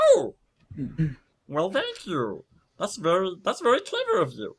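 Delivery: high-pitched praise with a chuckle; Cogs, impressed. Acted. Cogs praising Dagna's clever fix, high and delighted.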